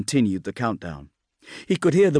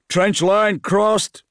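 commander's report; 9 semitones higher, 4 dB louder, much slower than usual. Acted. Bran reports to squad.

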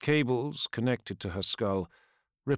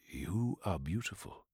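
soft admiring; 8 dB quieter than usual. Acted. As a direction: say it softly in awe. Telemachon quietly admiring.

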